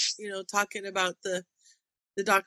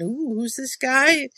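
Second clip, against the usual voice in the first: teasing; 5 semitones higher, 5 dB louder, slower than usual. Retold or imitated.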